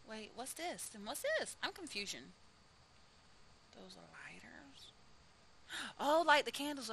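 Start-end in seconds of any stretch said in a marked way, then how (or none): 5.7-6.9 excited gasp. Real, not acted. Spots candles in haunted house.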